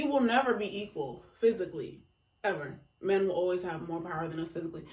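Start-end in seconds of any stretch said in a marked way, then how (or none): none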